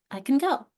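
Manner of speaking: I can go with can swallowed to uh; quick everyday I can go; natural reduced can. Shown as a demonstration. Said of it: "when I say it naturally".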